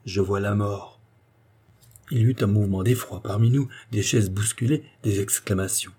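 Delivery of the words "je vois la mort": eerie cat voice. A narrator's reading. Cat answering Nestor with an eerie omen. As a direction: let the cat speak the omen darkly. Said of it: "elle répondit".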